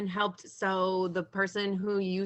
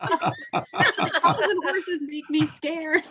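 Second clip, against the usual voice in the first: much higher and slow, laughing through the words; 9 semitones higher, 4 dB louder, slower than usual. Real, not acted. Vet admitting cows scare her, laughing high. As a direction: giggle out the confession.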